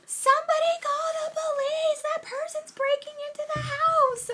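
high, piercing alarmed yell; shouting alarm. Retold or imitated. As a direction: raise the alarm at a stranger on the porch. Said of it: "start shouting".

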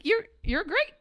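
high, eager overly sweet praise; butter him up, way too eager; gushing sweetness. Retold or imitated. Buttering up the contractor at the game, high gushing praise.

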